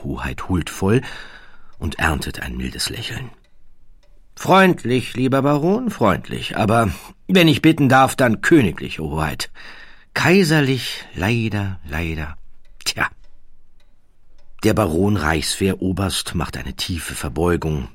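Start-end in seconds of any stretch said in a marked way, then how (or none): 4.3-13.1 high, nasal-free lofty aristocrat voice, much higher and louder; a condescending royal drawling his words; haughty prince. Acted. The prince corrects the baron, high and lofty.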